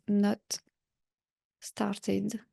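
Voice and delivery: English words with a French accent; slow, careful English in a French accent; accent français en anglais. Real, not acted. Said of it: "Désolée pour mon accent anglais". (anglais prononcé avec l'accent français)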